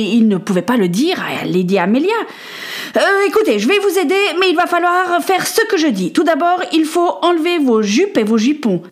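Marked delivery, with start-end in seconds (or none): 3.0-8.8 put-on higher, firm young voice taking charge; a boy keeping calm to give orders; earnest boy. A narrator's reading. Boy tells the lady what to do, higher and firm.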